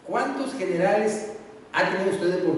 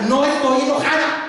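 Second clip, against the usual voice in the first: snapping wife; 9 semitones higher, 7 dB louder than usual. Acted. Wife snaps denial.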